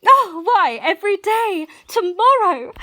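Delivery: high, bright burst of joy; excited exclaim. Acted. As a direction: blurt it out giddy and loud. Teased about her wedding, exclaims.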